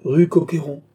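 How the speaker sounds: "Rue Coqueyron" stated firmly, falling at the end; phlegmatic repeat. A narrator's reading. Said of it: "répéta flegmatiquement Jean".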